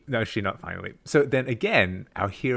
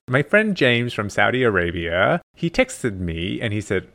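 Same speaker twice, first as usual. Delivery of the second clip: ordinary talk, nothing marked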